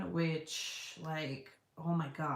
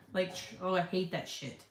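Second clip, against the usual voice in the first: normal talking voice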